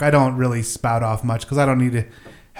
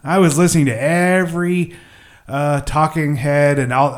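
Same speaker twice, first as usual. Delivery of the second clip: normal talking voice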